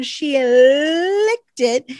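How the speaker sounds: LICKED stretched out on a long l; drawn-out emphasis. Real, not acted. Host telling on co-host, "licked" heavily drawn out. Dramatizing co-host licking the pen.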